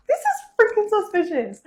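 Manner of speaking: high, loud thrilled suspicion; excited suspicion. Retold or imitated. Girlfriend sensing a proposal, high and thrilled. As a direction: squeak it out, barely containing it.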